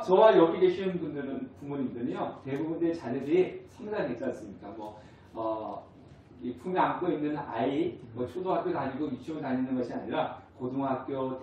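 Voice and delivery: ordinary delivery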